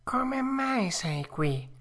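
high, slow fox question; drawn-out sly question; fox asking. A narrator's reading. Fox asking Pinocchio, slow and high. Fox asks first, cat repeats.

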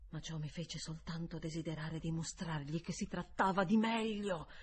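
ordinary delivery

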